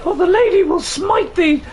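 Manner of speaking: high, loud archaic threat; mock biblical prophet. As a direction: proclaim doom in old speech. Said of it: "Remember that from Lord of the Rings?".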